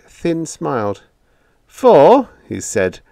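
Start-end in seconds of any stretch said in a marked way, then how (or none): 1.7-2.3 high, loud sly start. A narrator's reading. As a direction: tease the price. Biggs bargains coolly.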